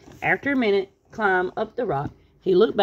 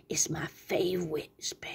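slow, soft whispered gloat; spooky witch whisper; 8 dB quieter, slower than usual. Acted. Tweety Witch relishing her spell, slow spooky whisper. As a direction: whisper it slowly to unsettle.

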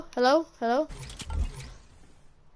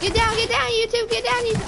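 higher, louder, hyped; excited yelling; 10 semitones higher, 3 dB louder, faster than usual. Real, not acted. Gamer yelling at an opponent in a fight, excited shout. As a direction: yell it at the enemy as you fight.

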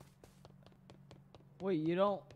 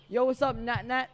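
hyped greeting; 8 semitones higher, 9 dB louder than usual. Real, not acted.

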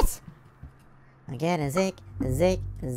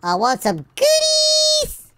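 slow, very high squealed demand; greedy mock squeal; slower than usual.

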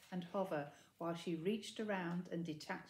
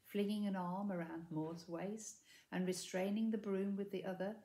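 ordinary delivery, nothing marked

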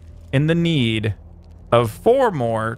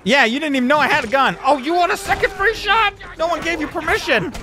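high, worked-up trash talk; high-pitched combat outrage; indignant taunting; 13 semitones higher than usual. Real, not acted. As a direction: scold the enemy in a raised pitch. Taunting enemy during game fight.

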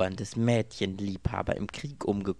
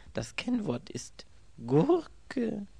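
slow and higher, the word Gurke falling in disbelief; puzzled man repeats the password; incredulous repeat; 13 semitones higher, much slower than usual. A narrator's reading. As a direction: turn Das Kennwort ist Gurke over slowly, not believing it.

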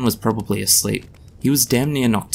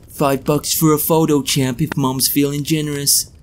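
Donald Trump impression; 5 semitones higher than usual. A narrator's reading. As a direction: hawk photos like a brash tycoon. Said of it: "a surprisingly convincing Donald Trump".

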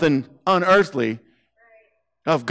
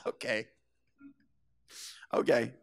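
much softer, let-down murmur; deflated okay; 6 semitones lower, 12 dB quieter than usual. Retold or imitated. Paralyzed man answering Jesus, softer deflated murmur. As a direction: swallow the disappointment quietly. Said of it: "the paralyzed guy would be like".

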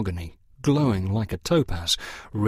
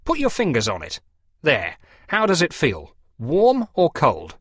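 coaxing parson; 6 semitones higher, 3 dB louder than usual. A narrator's reading. Parson to the farmers, higher coaxing questions. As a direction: draw them into the test.

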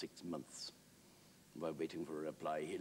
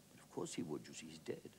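quietly self-evident answer; dry Wilde reply; 5 dB quieter than usual. Acted.

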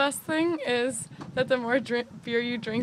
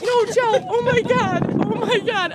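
scared shriek; 8 semitones higher, 8 dB louder than usual. Real, not acted. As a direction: shriek as if she'll fall. Friend climbing over balcony.

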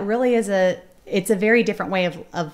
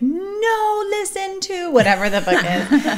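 put-on high, demanding little kid voice; toddler voice; 11 semitones higher, slower than usual. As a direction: imitate a bossy two-year-old. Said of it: "She'll be like".

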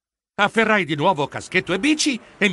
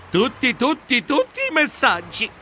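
TUTTI repeated at a higher pitch; emphatic repetition; 7 semitones higher than usual. A narrator's reading. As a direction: climb in pitch through each "tutti". Narrator exaggerates repeated word.

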